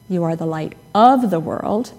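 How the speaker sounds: "of" punched out oddly before "the world"; stress on OF. Shown as a demonstration. Preacher recalling a childhood word game, stress on "of". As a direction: lean hard on the little word "of". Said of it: "and so on".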